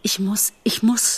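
hushed, rising desperation; insist, barely holding on; close to losing composure. A narrator's reading. Bambi torn by Faline's call, losing composure. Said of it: "die Fassung zu verlieren".

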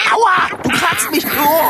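loud, high ouch; pained complaint. Acted. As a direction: complain sharply about the scratch. Paw scratched during panic.

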